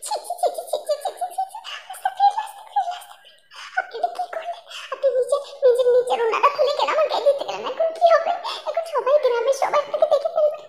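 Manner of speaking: high, squealing giggles through mortified protests; a girl giggling in scandalised embarrassment; embarrassed giggling. Acted. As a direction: squeal with shy laughter at the shock.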